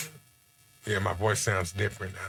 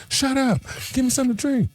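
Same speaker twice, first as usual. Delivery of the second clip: imitating his kid; 3 dB louder than usual. His kid interrupting the stream.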